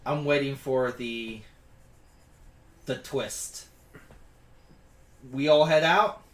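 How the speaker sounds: normal talking voice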